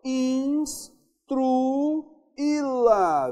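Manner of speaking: Instruí-la drawn out extremely slowly, leaning on í; very slow classroom drill on the í; stretched stress. Shown as a demonstration. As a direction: hold the í of Instruí-la as long as you can. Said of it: "Veja que tem acento aqui".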